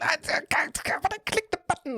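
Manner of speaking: very high, stammering outrage; shocked protest. Real, not acted. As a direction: squeal in disbelief. Misclick outrage.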